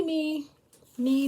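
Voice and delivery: ordinary delivery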